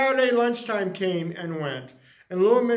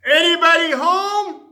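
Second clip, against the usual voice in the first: loud, higher call through the door; 9 semitones higher, 9 dB louder than usual. Acted. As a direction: call out to the house. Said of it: "he called".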